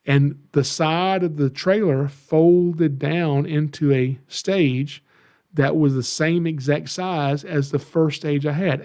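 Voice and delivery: ordinary talk, nothing marked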